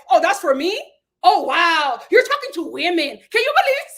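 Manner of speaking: loud, hammed-up disbelief; mock Alexia. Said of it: "Alexia was like".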